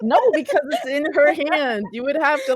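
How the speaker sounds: loud, high-pitched protest; amused exasperated objection. Real, not acted. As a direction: argue back loudly, half laughing. GM argues with player, amused.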